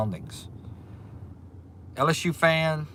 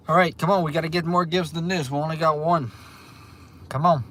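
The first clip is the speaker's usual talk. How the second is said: higher, lively rallying call; urging viewers on; 5 semitones higher, 4 dB louder than usual. Real, not acted. Streamer rallying viewers for more gifts, urging them on. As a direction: egg them on with raised pitch.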